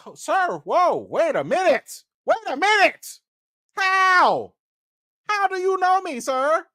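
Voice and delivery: high, startled questioning; stunned, asking how he knows you; stunned disbelief. Retold or imitated. Vlogger reliving his shock at the director knowing him, high and startled.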